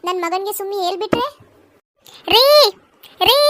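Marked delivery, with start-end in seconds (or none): 2.3-3.4 loud sharp call for him; shouted call. Acted. Wife shouts for her husband, loud and sharp. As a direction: yell for your husband. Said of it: "ಯಾಕೆ ಕಿರ್ಚಿದು?".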